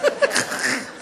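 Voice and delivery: high wheezy giggle; drugged snickering. Retold or imitated. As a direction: snicker helplessly as if sedated.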